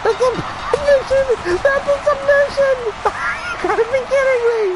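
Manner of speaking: very high, rapid, laughing shriek; shrieking disbelief. Real, not acted. Commentator sees a surprise submission win, shrieking disbelief. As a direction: squeal in helpless amazement.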